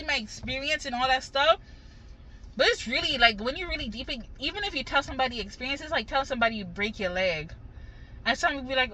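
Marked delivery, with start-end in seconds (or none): none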